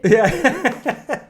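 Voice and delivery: high, loud laughing yeah; real laugh through yeah. Real, not acted. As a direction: crack up on the word. Laughing at therapy joke.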